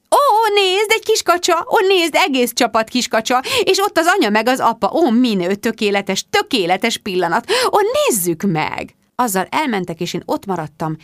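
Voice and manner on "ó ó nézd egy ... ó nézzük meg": very high, gushing coos that gradually come down; delighted squealing. A narrator's reading. Rebecca cuts Mark off over ducklings, squealing. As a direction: interrupt with exaggerated rapture.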